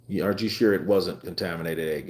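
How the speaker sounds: ordinary talk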